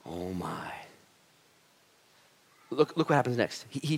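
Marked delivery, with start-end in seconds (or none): 0.1-0.7 breathless whisper of wonder; moved, whispered aside; awed whisper. Real, not acted. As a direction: let it slip out hushed. Awed reaction to the father's kiss.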